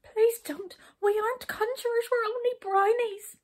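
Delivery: high, loud frightened pleading. A narrator's reading. Brownies begging the king not to arrest them, frightened plea. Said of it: "very frightened indeed".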